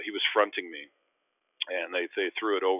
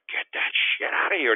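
higher, loud, half-whispered block call; retold block yell; 7 semitones higher, 5 dB louder than usual. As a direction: bark it out like a swat. Yells after blocking shot.

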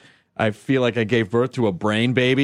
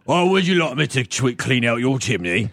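light Cockney sweep voice; cheerful chimney sweep. Pretend. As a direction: offer to clean the chimney. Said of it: "sounds like a just chimney sweep".

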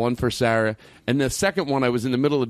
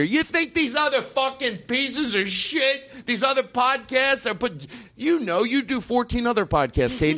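mock anger; 8 semitones higher than usual. Host mocking rival podcasts to his co-host, exaggerated mock anger. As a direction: overblown indignation at the rivals. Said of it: "like, I really have that much anger".

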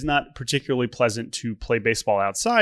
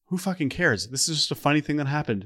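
ordinary talk

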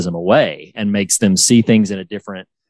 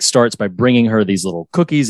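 ordinary delivery

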